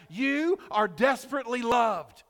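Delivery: ordinary talk, nothing marked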